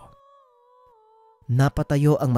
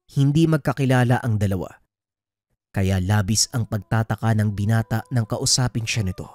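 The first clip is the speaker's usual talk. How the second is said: plain reading voice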